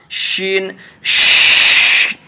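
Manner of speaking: शीन, then a hissed श- with no voice; sh sound demo. Shown as a demonstration. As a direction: isolate the श sound for learners. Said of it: "सीन लाम".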